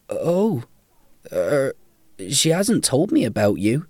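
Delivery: tentative, halting reply; hesitant young Malcolm. A narrator's reading. Narrator voices hesitant Malcolm.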